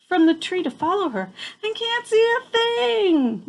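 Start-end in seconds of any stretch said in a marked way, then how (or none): 1.6-3.4 yelled. A narrator's reading. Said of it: "yelled Pip".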